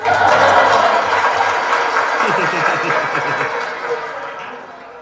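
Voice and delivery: breathy real chuckle at the hyenas. Real, not acted. Vlogger chuckling at the live crowd, breathy laugh. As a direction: chuckle it out, amused. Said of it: "And I just chuckled".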